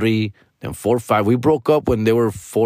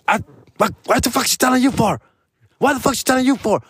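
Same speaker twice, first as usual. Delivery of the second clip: imitated angry outburst, much higher; mad; 11 semitones higher than usual. Ex furious the nanny told him about missed pay, mock outburst. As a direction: mimic her shrill fury at the nanny. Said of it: "she would get all mad".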